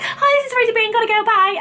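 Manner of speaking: high, hammed-up quick brush-off; mock her own hasty greeting; high mocking replay of the brush-off; mock rushed self.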